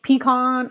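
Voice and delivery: pecan with an open "ah" at the end; pecan as pee-KAHN. Shown as a demonstration. Switching variants for viewers, pecan as pee-KAHN. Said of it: "I say it however".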